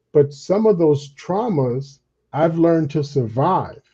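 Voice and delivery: usual speaking voice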